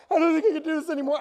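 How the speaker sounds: high, fast, laughing mock panic; hammed-up frightened wail; scared whimper. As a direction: imitate a terrified friend clinging on. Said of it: "he would hold on and be like".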